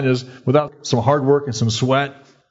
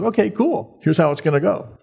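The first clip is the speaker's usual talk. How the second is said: casual God voice; 7 semitones higher than usual. Retold or imitated. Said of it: "He goes".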